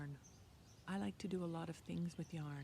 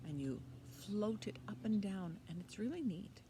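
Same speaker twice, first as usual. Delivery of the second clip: ordinary delivery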